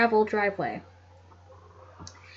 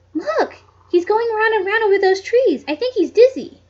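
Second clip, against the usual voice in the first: much higher, eager squeaky cry; excited girl; 10 semitones higher, 5 dB louder than usual. A narrator's reading. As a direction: point up in delight. Effie spots bird, excited.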